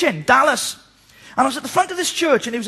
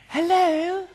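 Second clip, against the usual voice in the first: imitating a wife's sudden posh phone hello; 5 semitones higher, 3 dB quieter than usual. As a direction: switch to a prim, polished hello. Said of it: "She goes really posh".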